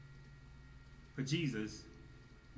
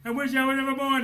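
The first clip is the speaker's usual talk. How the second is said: cried; 13 semitones higher, 12 dB louder than usual. Retold or imitated. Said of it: "he cried".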